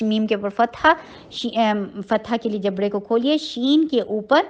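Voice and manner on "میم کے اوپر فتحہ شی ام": ordinary delivery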